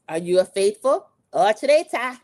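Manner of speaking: dramatic, loud, rising imitation of a host; hammed-up host question. Host asking Rob for the reveal, dramatically. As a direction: build it loudly to the last word.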